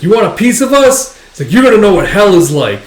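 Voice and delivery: acted belligerent shout; brawler's taunt. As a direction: goad them into a fight.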